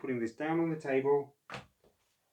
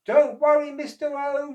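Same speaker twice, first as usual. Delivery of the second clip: high, loud reassuring call; called reply; 14 semitones higher, 11 dB louder than usual. Acted. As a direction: reassure Mister O across the water. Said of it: "replied Oscar".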